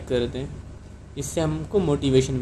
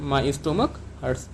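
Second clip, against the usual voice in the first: slow model sentence; 4 dB quieter, much slower than usual. Shown as a demonstration. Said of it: "तो आप doctor को कैसे कहेंगे?".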